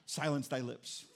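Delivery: low, formal mock God voice; solemn God voice. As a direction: intone it like a King James God. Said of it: "he doesn't go to Brian and go".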